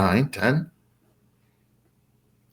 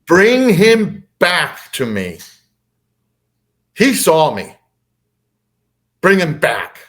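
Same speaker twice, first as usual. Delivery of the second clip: high, slow, booming command; loud, drawn-out imitation of a booming voice; 6 semitones higher, 5 dB louder than usual. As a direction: boom it out like an alien overlord. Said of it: "the voice boomed".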